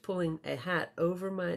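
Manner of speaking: ordinary delivery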